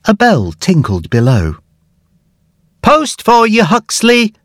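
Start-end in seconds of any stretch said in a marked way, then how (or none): 2.8-4.3 high, called-up greeting; friendly postwoman calling out. A narrator's reading. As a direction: call it up to the window. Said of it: "cried the postwoman".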